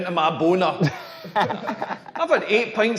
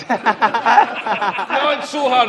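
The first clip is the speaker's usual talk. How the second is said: a hammed-up lover's tender plea; mock reassuring; 4 semitones higher, much slower than usual. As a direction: beg her gently, hammed up. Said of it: "more reassuring stuff".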